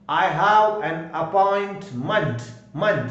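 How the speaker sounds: very slow, appointment ending in "munt"; weak-ending demonstration. Shown as a demonstration. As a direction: spell out appointment slowly, then repeat the weak "munt". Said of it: "मंट बोलोगे तो वीक है". (appointment को धीरे बोलें, अंत में हल्का "मंट")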